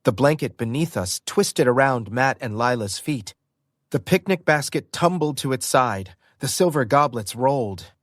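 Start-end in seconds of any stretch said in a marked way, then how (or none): none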